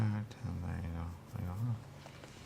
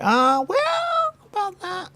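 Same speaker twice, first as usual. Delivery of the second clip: squeaky start; 6 dB louder, much slower than usual. Real, not acted. Sudden jump in voice.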